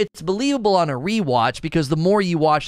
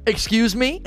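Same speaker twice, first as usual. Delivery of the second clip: higher, incredulous "excuse me"; mock indignant surprise; 7 semitones higher than usual. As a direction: pretend to be shocked at the kid. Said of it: "I'm like".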